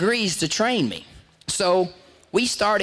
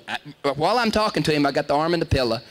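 ordinary talk

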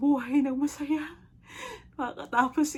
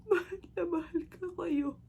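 high, slow, tearful goodbye; crying farewell; 6 semitones higher than usual. Acted. Dying mother says goodbye to her children, crying farewell. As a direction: sob out the love slowly.

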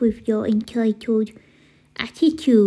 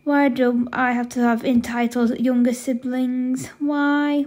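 ordinary delivery

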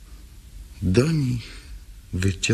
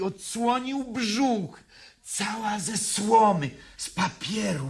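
much higher, fragile doll voice; high, small voice of a ragged angel doll; broken doll; 13 semitones higher than usual. Acted. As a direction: speak as a torn-apart doll. Broken doll figure speaks.

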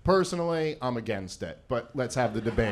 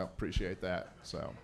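ordinary talk, nothing marked

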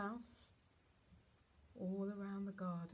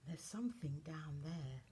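usual speaking voice

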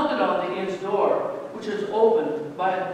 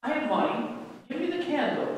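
mild mock shout; 8 semitones higher than usual. A narrator's reading. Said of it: "Jacob shouted".

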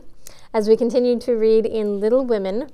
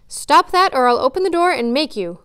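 loud, high threat through a door; a boy shouting a threat through his door; threatening; 4 semitones higher, 6 dB louder than usual. A narrator's reading. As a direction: warn him off hard, raising the voice. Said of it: "in a threatening tone".

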